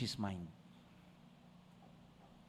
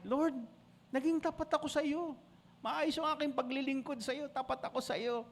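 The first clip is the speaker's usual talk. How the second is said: tearful plea; 11 semitones higher, 3 dB quieter than usual. Retold or imitated. Hezekiah's tearful prayer, softening.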